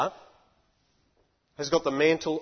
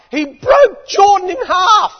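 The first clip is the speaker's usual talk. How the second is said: shouted high and loud, slowed; full-force preaching shout; emphatic shout; 12 semitones higher, 12 dB louder, slower than usual. Real, not acted. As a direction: proclaim it like a thunderclap. Preacher builds to climax.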